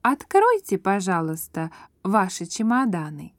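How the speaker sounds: ordinary talk, nothing marked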